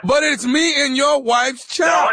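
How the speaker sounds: put-on slow, pleading emphasis through to "child"; insistent protest. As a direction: press the point, stretching out "child". Prankster insists against the husband's protest.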